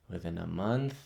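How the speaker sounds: low, soft and brooding; quiet bitterness. Acted. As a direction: murmur it to yourself in disbelief. Hamlet brooding on the hasty marriage.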